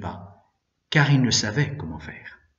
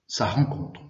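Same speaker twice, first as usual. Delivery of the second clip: usual speaking voice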